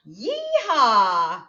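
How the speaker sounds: loud cowboy yeehaw. Shown as a demonstration. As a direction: whoop it out like a cowboy. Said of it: "Give me a yeehaw".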